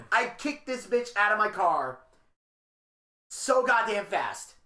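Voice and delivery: normal talking voice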